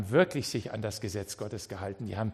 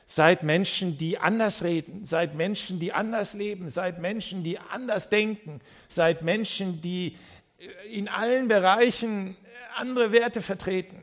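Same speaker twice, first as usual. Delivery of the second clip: rising higher with each call, driving the words home; preaching at full force; 6 semitones higher than usual. Acted. Preacher urging the congregation to be different, rising passionate exhortation. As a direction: preach it like a prophet building to a peak.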